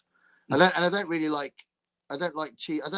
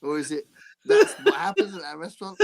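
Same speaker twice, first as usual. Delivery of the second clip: ordinary delivery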